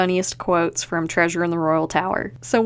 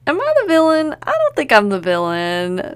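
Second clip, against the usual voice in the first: mock alarm; 7 semitones higher, 4 dB louder than usual. Real, not acted. Mock self-doubt about villainy.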